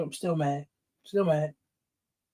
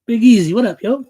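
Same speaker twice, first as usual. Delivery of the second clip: loud greeting; 5 semitones higher, 9 dB louder than usual. Real, not acted. Shout-out to a viewer.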